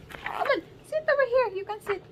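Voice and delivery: very high, sing-song coaxing; high cooing call to come closer. Real, not acted. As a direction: coax the little one over in a high cooing voice. Coaxing the baby to sit.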